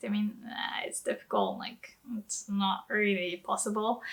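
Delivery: breathy whispered side note; quick hushed aside. Real, not acted. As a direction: drop to a whisper for the translation.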